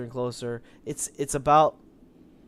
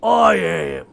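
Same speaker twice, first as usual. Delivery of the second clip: loud giant voice; 4 semitones higher, 11 dB louder than usual. Acted. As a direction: bark it as a giant. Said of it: "he's just like".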